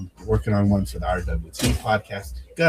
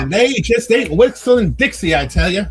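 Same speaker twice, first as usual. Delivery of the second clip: mock hillbilly; 12 semitones higher, 8 dB louder than usual. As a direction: ham it up like a hollering hillbilly. Mock hillbilly banter after banjo joke.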